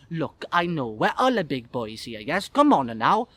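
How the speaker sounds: exasperated Italian waiter; Italian accent. Acted. Italian waiter humouring a joking guest, accented. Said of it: "Obviously Italian".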